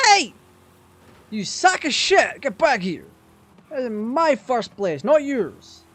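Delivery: high loud yelling at a cheater; angry shout. Real, not acted. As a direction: shout him down, furious.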